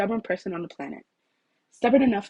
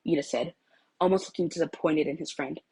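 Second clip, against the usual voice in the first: usual speaking voice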